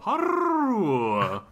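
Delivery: high, trilled fake-Irish "Haroo"; mock Irish rolled R. Host opens the accent contest, mock Irish trill. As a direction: roll the R in a hammed-up Irish cry.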